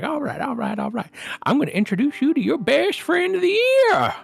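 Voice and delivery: high, loud gushing gnome; gnome salesman. Acted. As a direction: sell it like an excited little gnome. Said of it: "he's like".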